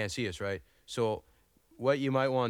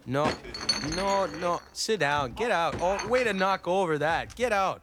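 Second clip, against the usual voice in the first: yelling; 7 semitones higher, 4 dB louder than usual. Real, not acted. Brother knocking things over.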